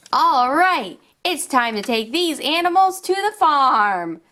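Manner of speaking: put-on upbeat, sing-song storytelling for little kids; toy play narration. Showing toys to young viewers, upbeat and sing-song. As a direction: play along like a kids' toy show host.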